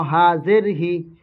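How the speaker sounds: ordinary talk, nothing marked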